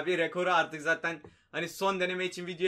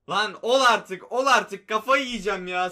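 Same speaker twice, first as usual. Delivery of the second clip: loud, exasperated yell at the game; frustrated outburst; 8 dB louder than usual. Real, not acted. Gamer yelling at the screen over bad draft ratings, exasperated. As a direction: snap at the screen in real frustration.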